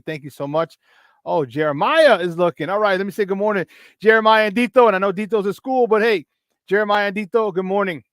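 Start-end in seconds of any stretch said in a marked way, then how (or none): none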